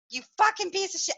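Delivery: high, fast retold cursing; retold yelling. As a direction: act out swearing at him without full volume. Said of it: "I'm swearing and I'm yelling".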